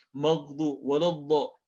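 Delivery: "waladdo" said as woloddo; slow "maghdu, waladdo", vowels rounded; common vowel mistake. Shown as a demonstration. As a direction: show "maghdu, waladdo" with A darkened to O. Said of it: "Sering jadi walad".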